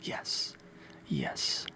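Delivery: ordinary delivery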